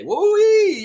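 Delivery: high, loud mocking whoop; big sarcastic woo-wee. Real, not acted. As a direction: holler it like a cowboy. Mocking the outrage.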